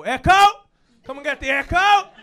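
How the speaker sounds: loud, high newspaper seller's shout; street vendor's cry. Acted. Showing the producer how vendors sell, full-voiced street cry. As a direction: holler it out like an Echo vendor. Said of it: "Echo vendors go".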